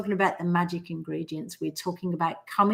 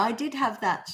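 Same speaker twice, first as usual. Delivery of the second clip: normal talking voice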